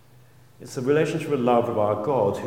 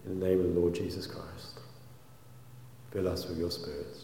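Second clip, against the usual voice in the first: prayer; 3 semitones lower than usual. Acted. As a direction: pray gently and sincerely to God. Leads the congregation in prayer.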